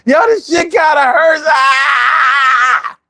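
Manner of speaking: high drawn-out cry of pain; hammed-up agonized scream; pained wail. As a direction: break from the rap into a howl. Rap breaks into pain scream.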